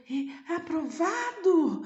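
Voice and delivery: high, loud and slow disbelieving question; surprised intonation. Shown as a demonstration. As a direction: show the boy's amazement at being approved. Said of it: "Ele ficou tão surpreso". (entonação de surpresa, aguda, forte e lenta)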